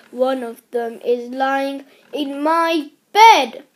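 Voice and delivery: loud, indignant storybook yell; outraged yell. A narrator's reading. William spying a duck in his bed, outraged yell. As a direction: raise the voice in protest. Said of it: "yelled William".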